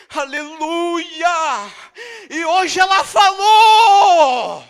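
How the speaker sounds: slow, loud and high, breaking with tears; crying shout. Acted. Preacher crying out the miracle to the church, sobbing shout. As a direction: shout it out as if weeping. Sermon climax, shout heard.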